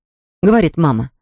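usual speaking voice

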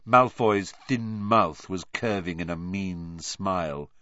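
ordinary delivery

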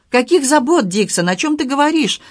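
a sudden jump of alarm in the voice; agitated questioning. A narrator's reading. Young lady asks Dixon about her father's worries, agitated. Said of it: "заволновалась Элеонора".

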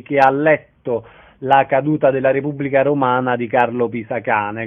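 usual speaking voice

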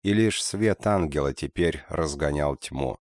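ordinary delivery

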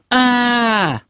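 long high-pitched scream; piercing shriek. A narrator's reading. Kira shrieking at his secret, piercing. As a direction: shriek in shock, held on one high note. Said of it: "пронзительно завопила Кира".

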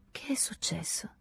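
timid whisper. A narrator's reading. Timid question in the dark.

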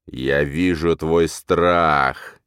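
very slow, drawling menace; drawn out. Acted. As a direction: stretch every word out. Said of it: "протягивает некто". (протяжно, с угрозой)

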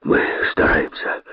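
soft, breathy effort; straining guard. A narrator's reading. Guards answering the king while dragging, strained voice. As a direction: say it under strain. Guards struggling to drag Kosoy.

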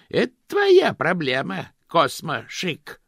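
high, loud shrill outburst; exclaiming. Acted. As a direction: cry out at him in outrage. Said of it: "воскликнула Жозефина".

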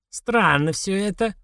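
put-on slow, puzzled old crone; old woman voice. A narrator's reading. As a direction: muse it like a wary old woman.

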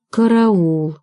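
plain reading voice

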